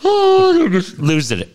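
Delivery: high mock wail of upset kids; kids losing it. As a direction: imitate children wailing, high and drawn-out. Imitates kids losing it with wails.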